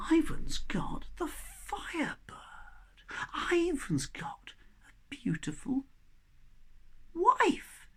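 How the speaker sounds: high, envious whisper; jealous whisper. Acted. The brothers whisper about Ivan's wife, jealous and hushed. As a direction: hiss your envy to your brother. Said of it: "hearts are filled with jealousy".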